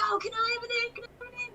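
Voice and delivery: high-pitched imitation of begging kids; imitated high little voices; pleading children. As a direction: beg like an eager child. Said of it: "the rest of the group would be like".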